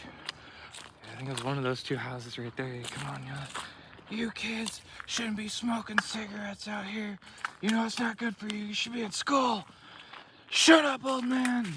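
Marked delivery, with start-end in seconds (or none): 4.1-9.6 high put-on scolding old-man voice; old neighbour scolding. Mocking the neighbour who yelled at them as kids, high mock scold. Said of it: "imagined neighbour yelling at kids". 10.5-11.7 high, loud bratty shout; bratty kid yelling back. Retold or imitated. The kids shouting back at the old neighbour, loud bratty yell. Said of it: "kids yelling back at neighbour".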